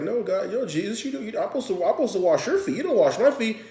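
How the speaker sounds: squeaky high voice of a flustered disciple; high mock disciple.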